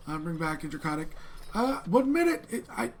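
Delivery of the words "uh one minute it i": high, loud dragonborn innkeeper; Valnore dragonborn voice. Acted. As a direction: call out high and loud from the back room. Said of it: "a female dragon, uh, born voice".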